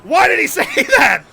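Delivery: high, loud re-enacted outrage; incredulous retold shouting; shocked disbelief. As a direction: blurt it out, appalled and half laughing. Retelling shocked reaction to video.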